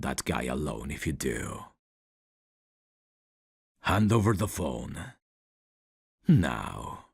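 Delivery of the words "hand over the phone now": raised, louder order; louder demand, ending on a forceful drop; firm demand. Acted. Man ordering the professor to give up the phone, firm demand.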